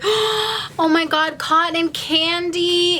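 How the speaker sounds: gasp, then high drawn-out delight; real thrilled discovery; delighted surprise. Real, not acted. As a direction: stretch it out high with glee. Delighted at candy find.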